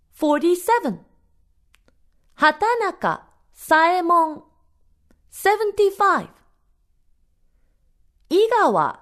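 high, eerie doll voice; talking doll. A narrator's reading. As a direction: chant the names like a haunted doll. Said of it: "and it began to speak".

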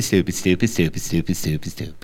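ordinary delivery, nothing marked

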